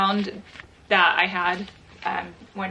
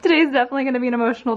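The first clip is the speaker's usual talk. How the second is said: laughing, getting emotional; 4 semitones higher, 9 dB louder, much faster than usual. Real, not acted. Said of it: "I'm gonna get emotional".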